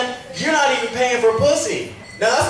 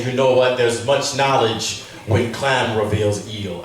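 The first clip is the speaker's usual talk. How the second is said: lower, slow guru voice; mock Eastern wisdom; 9 semitones lower, slower than usual. Comic offering Eastern wisdom to the woman, low slow sage voice. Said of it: "I was trying to say".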